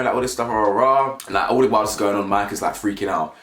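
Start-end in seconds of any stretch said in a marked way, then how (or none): none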